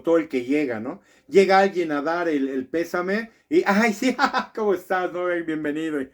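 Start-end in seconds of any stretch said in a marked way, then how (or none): 3.6-6.0 mock cheery greeting. Mourner greeting someone who brings condolences, mock laughing and cheery. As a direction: greet them through a put-on laugh.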